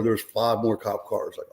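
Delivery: ordinary talk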